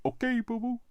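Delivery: Yogi Bear. Retold or imitated. Yogi Bear impression continues.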